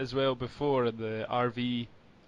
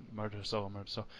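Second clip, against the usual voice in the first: fading echo, each repeat softer and further off; 4 semitones lower, 12 dB quieter, faster than usual. Retold or imitated. Retelling Homer's line echoing back, fading away. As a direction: let it die away like an echo off the trees. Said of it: "it echoes".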